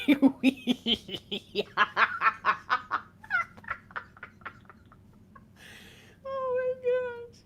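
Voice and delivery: real helpless laughing fits; real laughter breaking through, ending in a high, slow gasp; spirit laughter. Real, not acted. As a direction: let the laugh overtake the words. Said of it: "forgive me when I laugh like that".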